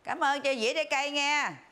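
high-pitched call to a departing friend; calling out thanks. Acted. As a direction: call your thanks after her, voice lifted high. (gọi với theo cảm ơn)

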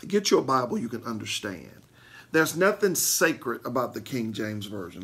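ordinary talk, nothing marked

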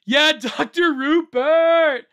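high, loud, stretched-out name; excited cheer. Real, not acted. Streamer spotting another great follower, excited drawn-out cheer.

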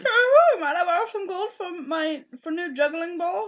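high, loud playful jester. Acted. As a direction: squeal it like a giddy court jester. Jester asking for gold.

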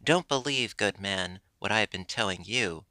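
ordinary delivery, nothing marked

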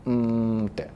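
trailing, barely audible hum; a weak mumbling hum with eyes shut; timid mumble. Shown as a demonstration. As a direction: sink into a small, unsure murmur. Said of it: "目つぶっちゃう感じですよね、こうやって".

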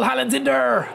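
high eager shout about the open striker; excited cry. Real, not acted. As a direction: yelp as he spots the run. Seeing a striker free live.

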